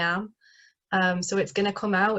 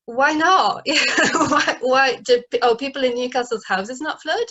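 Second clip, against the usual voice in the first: high, loud, laughing disbelief; incredulous laughing; 4 semitones higher, 5 dB louder than usual. Real, not acted. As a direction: scoff at it with a laugh. Mocking the ladies' stereotype.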